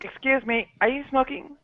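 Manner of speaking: mock a prissy woman, light and high; lady voice. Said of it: "she slips into her lady voice".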